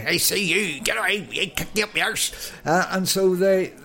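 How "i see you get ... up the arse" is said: mock Irish scold, lifted a little higher; grumpy Irish neighbour. Irish garage owner shooing football kids away, mock Irish scolding. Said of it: "They said it in an Irish accent".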